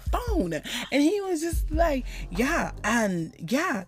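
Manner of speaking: high, slow over-explaining voice; imitating a man slowing his words for a foreigner; slow-talking American. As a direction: talk down to a foreigner, slowly. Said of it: "they slow their words down".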